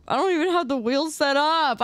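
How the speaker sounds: ordinary talk, nothing marked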